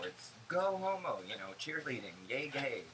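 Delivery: cheerleading cheer. Retold or imitated. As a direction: chant "go homo" like a cheerleader. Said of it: "I'm like".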